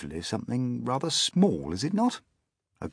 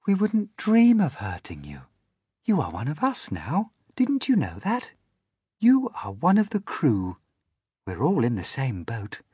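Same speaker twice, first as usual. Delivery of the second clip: higher, gentle ladybird; kindly ladybird; 5 semitones higher than usual. Acted. Ladybird reassuring James he is one of them, high and kind. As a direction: welcome him like family. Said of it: "the ladybird said kindly".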